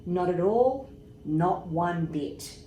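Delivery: usual speaking voice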